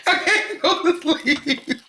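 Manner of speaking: put-on high, dragged-out drunk wail through laughter; friend's text voiced as a high, slow drunk whine; mock drunk complaint. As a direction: whine it slow like you're wasted and wide awake. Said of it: "Keith was texting me".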